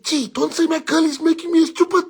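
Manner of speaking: pleading friend. Retold or imitated. Friend in love begging him not to insult his girl, high whine. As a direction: whine it like a smitten fool. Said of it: "He said, like".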